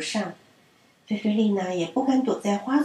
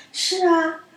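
high, louder happy answer; loud glad reply; 8 semitones higher, 3 dB louder than usual. A narrator's reading. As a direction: answer back with joy. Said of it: "菲菲丽娜也高声地回答说". (高声开心地回答)

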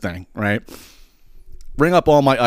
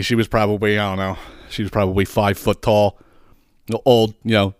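usual speaking voice

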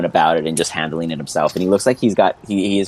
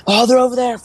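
high-pitched alarm cry; yelling; 4 dB louder than usual. Acted. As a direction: yell high to point out the enemy. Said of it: "People are yelling".

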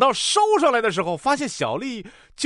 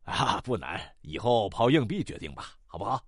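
low, soft reassuring physician; calm doctor; 11 semitones lower, 8 dB quieter than usual. Acted. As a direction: advise the patient in a deep, quiet voice. Doctor voiced against anxious patient.

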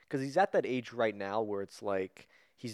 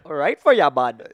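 put-on loud, higher hockey-player Canadian accent; 4 semitones higher, 11 dB louder than usual. Podcaster asked for a hockey accent, exaggerated Canadian. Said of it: "of a hockey accent".

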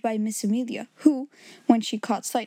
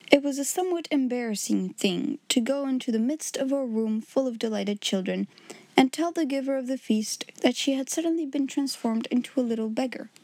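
usual speaking voice

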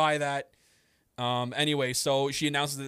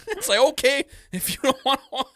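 higher, louder through laughter; giggling exasperation; laughing; 10 semitones higher, 7 dB louder than usual. Real, not acted. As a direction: laugh it off in disbelief. Laughing at the commenters.

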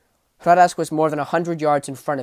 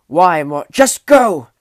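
higher, louder desperate order; urgent shout; 4 semitones higher, 13 dB louder, slower than usual. A narrator's reading. Wounded knight orders the king to flee, loud urgent shout. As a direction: push him away with the words.

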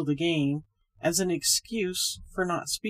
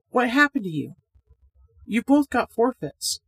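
louder calling voice; 6 semitones higher, 5 dB louder than usual. A narrator's reading. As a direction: call across the room. Reggie calls out.

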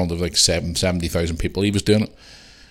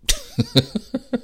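laughing. Real, not acted. Laughs at own joke.

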